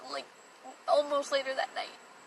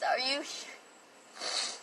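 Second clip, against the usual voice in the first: whispered plea; 7 dB quieter, much slower than usual. Retold or imitated. Pleading with boyfriend leaving her.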